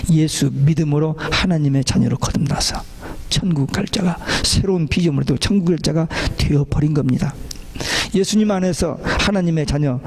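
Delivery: usual speaking voice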